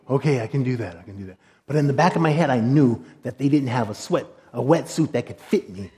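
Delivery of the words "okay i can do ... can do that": low and soft, fading away; retold murmur to himself, trailing down; muttered self-reassurance. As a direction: quietly talk yourself into it. Reassuring himself after hearing the rules.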